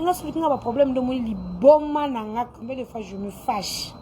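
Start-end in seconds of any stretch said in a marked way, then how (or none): none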